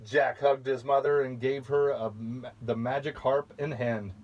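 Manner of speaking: ordinary delivery, nothing marked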